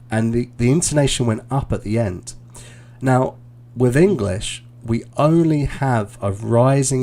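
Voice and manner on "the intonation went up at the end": ordinary talk, nothing marked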